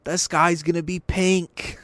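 hammed-up panic; mock conservative. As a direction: gullible fan breaking the news. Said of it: "They'd be like".

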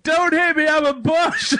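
high-pitched mock panicked shouting; imitated shout. Q running away from the coach, shouting. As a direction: shout it like someone fleeing at full sprint. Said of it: "full-on sprint shouting".

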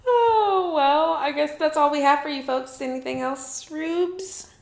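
high, bright announcer sign-off; show host voice. Pretend. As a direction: wrap it up like a TV host.

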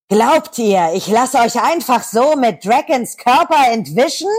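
high, slow, sneering villain voice; mocking villain challenge; villainess taunt. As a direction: taunt the heroes as the cartoon villainess. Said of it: "Shigo stellt sich ihnen natürlich in den Weg und sagt dann".

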